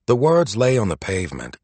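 plain reading voice

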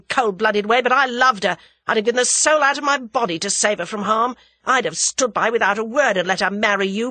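loud, angry tirade at his rival; furious outburst. Acted. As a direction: shout it at him, heartbroken and raging. Tommy's outburst at Julius.

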